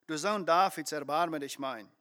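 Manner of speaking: ordinary talk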